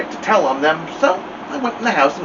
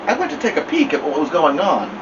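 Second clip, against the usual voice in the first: ordinary delivery, nothing marked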